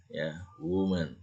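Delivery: woman said slowly as wuman with a full u vowel; careful woman. Shown as a demonstration. Teacher slowly models how to read woman. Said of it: "Kalau jamak itu women".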